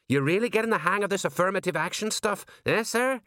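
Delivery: high-pitched, dutiful underling voice; high, respectful sergeant reporting; eager deferential sergeant. A narrator's reading. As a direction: report to the boss, voice pitched well up. Sergeant's character voice.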